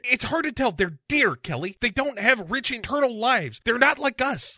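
high squeaky put-on aggrieved naturalist; silly high complaining voice; Scotty voice. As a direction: whine it high, put upon. Voicing Scotty in bit.

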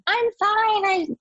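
high-pitched mock yell of protest; insistent, high cry, held back in volume; retold yelling. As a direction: re-enact yelling from the background, voice jumping high. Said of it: "I'm in the background yelling at him".

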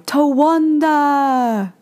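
a slow, stretched battle yell of her alter ego; battle cry. Retold or imitated. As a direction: yell it long like charging into battle. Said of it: "she would yell her battle cry".

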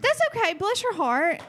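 high-pitched, teasing condolence; mock sympathy. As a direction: pretend to pity them, sweetly patronizing. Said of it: "No, I'm kidding".

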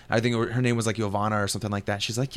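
ordinary talk

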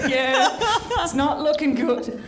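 laughing through the words, high; real laughter spilling over. Real, not acted. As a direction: breaks into giggles mid-line. Group laughing at grim news.

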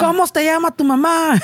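mock kid voice. Mocking kid asking about mom.

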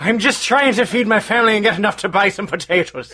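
lilting stage-Irish pleading; put-on Irish excuse; mock Irish accent. Immigrant explaining himself to angry local, mock Irish accent. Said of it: "Oi, Irish person".

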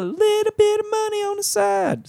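high, goofy playful voice; silly high voice. Acted. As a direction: pitch it way up and silly. Playful voice on plug.

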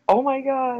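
high shocked cry of disbelief; retold shock. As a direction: gasp it out like hearing bad news. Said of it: "I'm like".

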